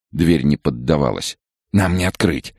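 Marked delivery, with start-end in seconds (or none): none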